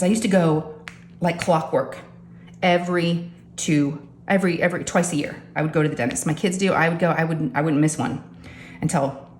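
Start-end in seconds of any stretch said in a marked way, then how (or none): none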